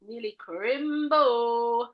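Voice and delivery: stretched out, very slow and playful; playful drawl. Pretend. Co-host teasing about Christmas, playfully drawn out. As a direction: draw it right out for fun.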